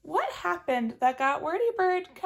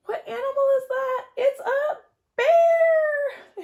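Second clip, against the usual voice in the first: very high, slow, loud cry; alarmed; 12 semitones higher, 6 dB louder, slower than usual. Acted. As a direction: gasp it out in alarm. Alarmed discovery.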